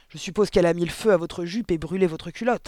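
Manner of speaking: loud, aggressive jibe; snarling retort. A narrator's reading. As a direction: snap back with scorn. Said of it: "répliqua madame, mademoiselle Le Gourdin, hargneuse".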